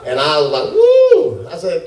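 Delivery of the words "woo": high, loud drawn-out "woo"; retold whoop. Patient sees the huge syringe, high startled whoop. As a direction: let out a long, high whoop.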